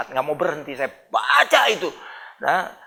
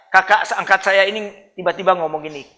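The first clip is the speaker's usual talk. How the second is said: ordinary talk, nothing marked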